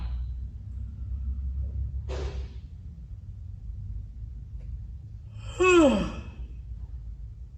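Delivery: long breathy yawn ending in a sigh. Shown as a demonstration. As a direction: yawn wide, then push the air out in a sigh. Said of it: "end it with a sigh".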